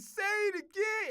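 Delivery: high, loud, slow command; mock demand. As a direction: insist on hearing it again. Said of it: "He said".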